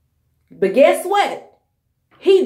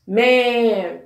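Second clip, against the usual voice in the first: ordinary delivery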